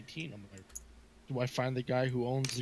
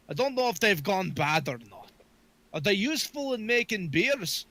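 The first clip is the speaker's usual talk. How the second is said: put-on higher, lilting Scottish accent; Scottish dwarf; 7 semitones higher than usual. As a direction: quiz the barkeep like a curious Scot. Player voices Scottish dwarf.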